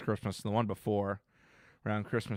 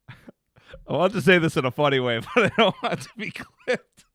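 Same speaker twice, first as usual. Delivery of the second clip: laughing; 4 semitones higher, 4 dB louder than usual. Real, not acted. Laughing while telling a story.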